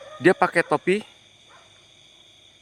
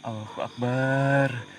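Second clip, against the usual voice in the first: slow invocation; 3 semitones lower, 3 dB quieter, much slower than usual. Real, not acted. Invoking God facing the spirit.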